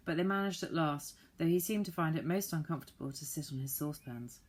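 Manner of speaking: usual speaking voice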